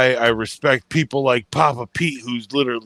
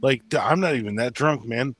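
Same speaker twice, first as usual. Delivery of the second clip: usual speaking voice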